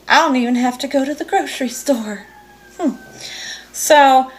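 face mask on, lips free; talking with mask on face; through a sheet mask. Real, not acted.

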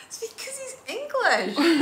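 slow, laughing tease. Real, not acted. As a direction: chuckle through the jab. Teasing about John's quirks.

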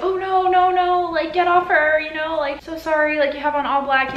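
high-pitched imitation of a fretting neighbor; apologetic dog owner. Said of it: "And the guy's like".

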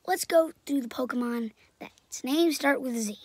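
ordinary talk

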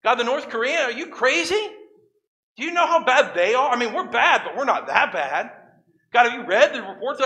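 high, loud mock outrage with a laugh; incredulous protest. Congregant protesting to God, high, loud and incredulous. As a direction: act shocked at God's plan, laughing. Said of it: "What would your response be?".

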